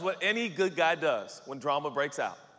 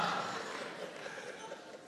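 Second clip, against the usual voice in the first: drawn-out acted guffaw; hearty laugh; 11 dB quieter than usual. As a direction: laugh long at your own flirty joke. Joe laughs at his own joke.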